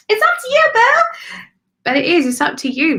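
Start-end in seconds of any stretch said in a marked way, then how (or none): 0.1-1.4 squeaky high cheeky voice, much louder; cheeky card voice. Retold or imitated. Voicing the tarot card figure, high and cheeky. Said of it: "she's like".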